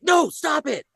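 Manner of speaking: high, squeaky inner cry; inner panic voice. Retold or imitated. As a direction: squeak at yourself in horror. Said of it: "reacting inside".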